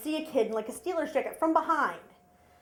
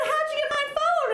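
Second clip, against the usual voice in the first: innocent little voice; 14 semitones higher than usual. Retold or imitated. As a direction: squeak it like a guilty kid. Daughter voiced high again.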